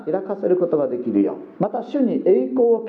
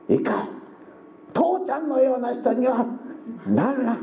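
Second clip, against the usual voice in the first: higher-pitched imitation of a mother lecturing her son; mother warns her son, higher mock voice; mock mother warning; 8 semitones higher than usual. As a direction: play the mother telling her boy not to become like his dad. Mother scolding son, quoted.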